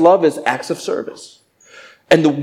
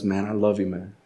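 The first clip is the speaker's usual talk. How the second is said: soft earnest; 6 semitones lower, 10 dB quieter than usual. Retold or imitated. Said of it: "when someone says".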